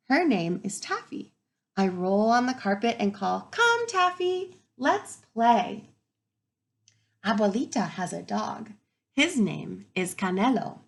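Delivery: lively storybook read-aloud; reading to children. Acted. Teacher reading to her class, lively and expressive. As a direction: bring the story alive for the kids.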